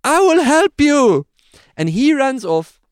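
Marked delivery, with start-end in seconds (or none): 0.0-1.3 high, loud, slow put-on beekeeper voice; playing the eager rescuer, hammed up high; beekeeper Simon. Beekeeper Simon promising to rescue the stung narrator, hammed-up high voice. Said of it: "He says".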